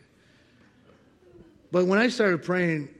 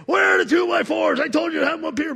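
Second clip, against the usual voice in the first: high, loud angry yell; boss yelling; 11 semitones higher, 7 dB louder than usual. Retold or imitated. Imitating a military boss yelling at him, high loud. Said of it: "he'd yell at me".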